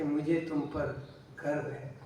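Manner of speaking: softly voiced quote of a daughter, low and subdued; hushed, understated retelling; quiet retold words. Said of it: "वो बेटी जब चुपचाप".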